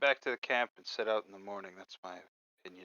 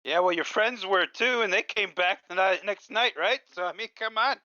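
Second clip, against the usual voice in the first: teasing argument; 8 semitones higher than usual. Acted. Arguing the dead may return.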